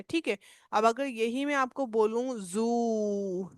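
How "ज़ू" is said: जू with a long stretched vowel. Shown as a demonstration. Teacher giving zoo as example, long जू. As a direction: slowly draw out जू. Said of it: "long ओ का sound आ रहा है".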